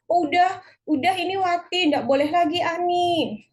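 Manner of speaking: loud, high mock classroom objection; objecting pupil. Acts pupils shutting out a classmate.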